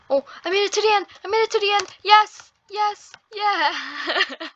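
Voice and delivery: high, loud victory cheer; triumphant excitement. Real, not acted. Player reaching the end of the level, triumphant excitement.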